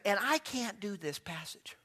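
soft, slow imitated old lady; sweet old lady. Widow objecting to the preacher, soft old-lady voice. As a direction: quietly voice a widow's protest. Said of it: "And she said".

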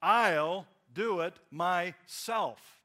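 very slow, loud, each word set apart, "myself" weighted; emphatic stress. Shown as a demonstration. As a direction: punch out "I'll" and "myself" with gaps between. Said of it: "I'm emphasizing something".

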